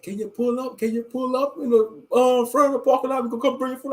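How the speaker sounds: high mocking imitation of the worker; drive-thru worker asking you to park, mocked high. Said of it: "they be like".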